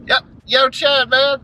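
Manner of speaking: a hint of a good ol' boy drawling at a party. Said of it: "my, uh, redneck guy voice".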